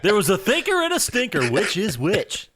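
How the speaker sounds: bright morning show host delivery; morning radio voice. Real, not acted. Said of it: "my morning radio voice just popped out".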